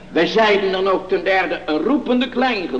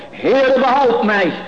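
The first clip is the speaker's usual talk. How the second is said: higher-pitched, drawn-out plea; imitated cry for help, preached; calling out; 7 semitones higher, 5 dB louder, slower than usual. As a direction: cry out slowly like a preacher quoting a desperate prayer. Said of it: "riep hij".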